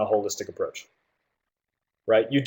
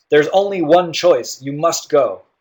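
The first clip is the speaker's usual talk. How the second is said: firm, insistent, higher-pitched, slow delivery; emphatic; 3 semitones higher, 4 dB louder, slower than usual. Shown as a demonstration. Teacher demonstrating an emphatic tone to students, emphatic. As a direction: state it emphatically, with no room for argument. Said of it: "Here's an emphatic tone".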